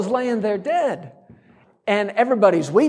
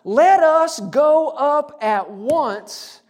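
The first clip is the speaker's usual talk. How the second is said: slow, higher-pitched proclamation; rally the people, word by word; measured, stirring call to action; rallying declaration; 7 semitones higher, much slower than usual. Acted. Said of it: "quieted the people before Moses and said".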